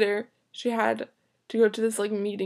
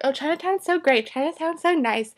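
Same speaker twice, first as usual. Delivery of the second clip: higher, put-on gushing voice; mock gushing; 6 semitones higher than usual. Friends raving to her about Chinatown, mock gushing. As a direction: gush like friends who love it.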